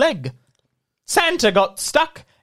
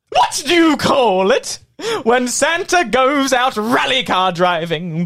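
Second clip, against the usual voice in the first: usual speaking voice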